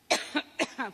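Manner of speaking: short dry cough; coughing fit. Real, not acted. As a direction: cough into the mic. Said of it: "I've gotta get a lozenge".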